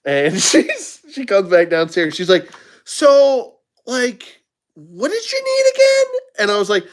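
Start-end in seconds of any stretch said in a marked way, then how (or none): 2.8-6.2 very high, puzzled question; high confused waitress voice. Retold or imitated. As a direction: squeak it like a lost waitress.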